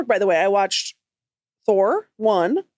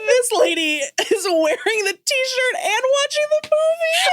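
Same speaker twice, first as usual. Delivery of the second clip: much higher, laughing through the words; laughing mock report. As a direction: crack up while voicing her. S1 voicing waitress, laughing.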